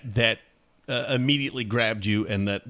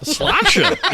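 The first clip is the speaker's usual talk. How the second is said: laughing toast; 6 dB louder than usual. Real, not acted. Toast answered while laughing.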